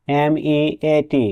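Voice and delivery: very slow, letter-by-letter spelling of এমইএটি. Shown as a demonstration. Teacher spells a word for students, drawn out letter by letter. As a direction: stretch out each letter of এমইএটি. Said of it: "মিট".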